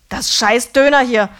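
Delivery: loud, bitter swearing; angry rant. A narrator's reading. Döner man cursing his own food, loud and angry. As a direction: spit the words out in fury.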